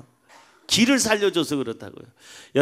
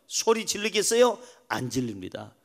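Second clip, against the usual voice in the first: ordinary talk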